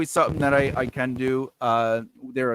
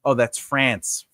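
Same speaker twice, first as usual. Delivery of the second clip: normal talking voice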